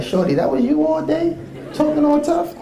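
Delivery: high giggly mock jab; laughing mock taunt. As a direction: needle him while cracking up. Teasing the short deli owner.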